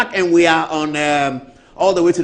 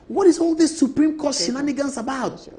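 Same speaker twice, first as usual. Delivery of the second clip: hammed-up pushy colleague urging; high pitched mock nagging about the bill; imitating Brother Fo; 10 semitones higher than usual. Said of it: "I said".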